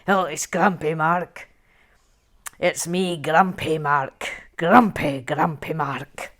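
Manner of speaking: slow, emphatic Scottish grump; Grumpy Mark. Shown as a demonstration. Host trying out the character, slow Scottish accent. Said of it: "veering slightly towards Scottish".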